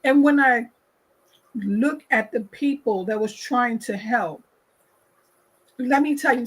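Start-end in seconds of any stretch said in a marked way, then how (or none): none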